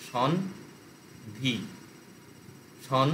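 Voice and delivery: "সন", long pause, "ধি"; broken into syllables. Shown as a demonstration. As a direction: drop "সন" and "ধি" as separate pieces. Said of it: "ওটাকেও syllable ভেঙে নিতে হবে". ("সন ... ধি" ভেঙে ভেঙে)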